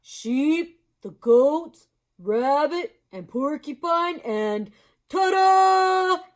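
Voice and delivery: slow, loud, friendly moose. Acted. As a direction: draw it out slowly and loudly. Mister Moose voice.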